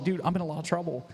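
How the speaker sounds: panicked plea. Retold or imitated. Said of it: "I was like".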